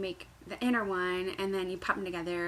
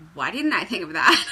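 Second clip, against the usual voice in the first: loud frustrated outburst; loud exclaim; 3 semitones lower, 10 dB louder than usual. Real, not acted. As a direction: blurt it out loudly. Excited outburst at friend's idea.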